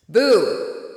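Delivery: sudden loud ghost boo; jump-scare shout of boo; startling boo. Acted. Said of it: "That's a ghost with a hillbilly accent".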